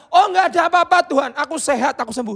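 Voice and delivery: high, breezy brush-off; mock denial. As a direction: wave it off lightly. Hypothetical denial by Bartimaeus.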